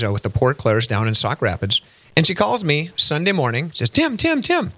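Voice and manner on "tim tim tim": much higher-pitched imitation of an excited sister. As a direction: call out eagerly, way up high, as the sister. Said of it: "She's always so excited".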